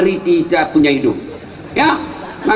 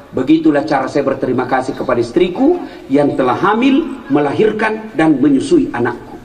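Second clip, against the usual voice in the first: lower, calm and earnest; sincere reply; 8 semitones lower than usual. Retold or imitated. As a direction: answer gently with gratitude. Umar's wise answer.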